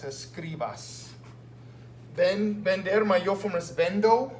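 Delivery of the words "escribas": escribas stretched slowly and softly; slow demo. Shown as a demonstration. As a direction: draw escribas out for the class. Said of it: "I change the O to A S".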